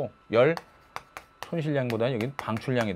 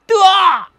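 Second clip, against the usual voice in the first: exclamation; 12 dB louder, much slower than usual. Acted. Lecturer's exclamation at a picture.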